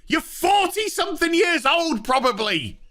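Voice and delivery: high, squealing exasperated outburst, slow on the insult; shrill mock rant. Reviewer disgusted by the show, high squealing rant. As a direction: squeal it like a fed-up diva. Ranting about childish scene.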